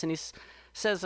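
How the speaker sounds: usual speaking voice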